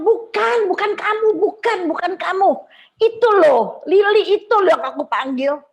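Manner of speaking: high, loud waving-off call. Retold or imitated. Waving off the wrong friend, high and loud. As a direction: wave him off and call her again. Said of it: "Terus saya bilang".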